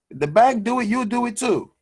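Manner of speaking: usual speaking voice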